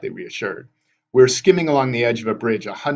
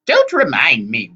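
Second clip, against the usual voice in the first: slow, raised wail of complaint; wailing hag; 4 semitones higher, 7 dB louder, slower than usual. A narrator's reading. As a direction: wail it slowly and loudly. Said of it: "Wasp wailed".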